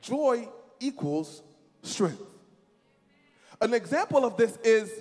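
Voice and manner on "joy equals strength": slow, drawn out. Shown as a demonstration. Preacher having the church say it again, slowly. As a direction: stretch Joy equals strength slowly. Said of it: "Say it again".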